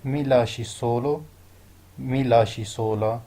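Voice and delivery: mi lasci solo then sola, very slow; slow careful Italian; slow teaching demo. Shown as a demonstration. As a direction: spell both forms out slowly for learners. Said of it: "تو Italian میں کہیں گے".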